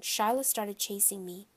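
plain reading voice

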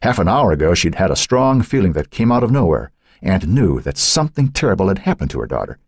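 plain reading voice

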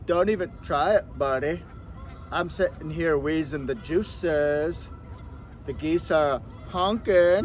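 slow, laid-back surfer-dude drawl; a lightly done Pauly Shore voice; Pauly Shore impression. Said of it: "Worst Pauly Shore impression ever".

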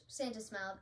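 usual speaking voice

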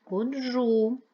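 Ходжу with a loud, high, long -джу; stress on second syllable. Shown as a demonstration. Teacher sorting words by stress, second-syllable stress on Ходжу. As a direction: punch and hold the end of Ходжу. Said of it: "Другий".

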